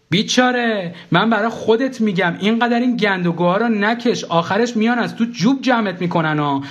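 high, pressing lecture; exasperated scolding. Acted. Ex-wife warns narrator about his smoking, exasperated scolding. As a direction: tell them off insistently.

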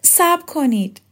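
high-pitched urgent cry; calling out. A narrator's reading. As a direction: call after someone to stop them. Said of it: "لقا فریاد زد". (با فریاد و صدای زیر)

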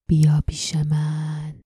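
slow breathy whisper. A narrator's reading. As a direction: whisper it slowly, pulling her in. Voice calling again in mind.

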